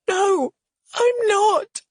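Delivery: very high, loud, tearful outburst; furious sob. A narrator's reading. Hurt woman answers if she's all right, furiously sobbing. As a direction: sob it out in fury. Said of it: "in a furious sob".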